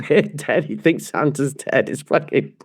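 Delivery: usual speaking voice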